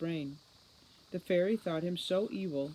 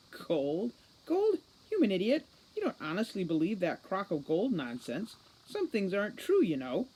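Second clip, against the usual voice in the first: incredulous scorn; 4 semitones higher, 4 dB louder than usual. Acted. Holly incredulous, then mocking.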